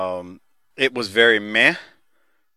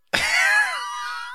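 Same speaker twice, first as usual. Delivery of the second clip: a high squealing burst of laughter; high shrieking laugh; 5 dB quieter than usual. Real, not acted. Call reaches voicemail, bursts out.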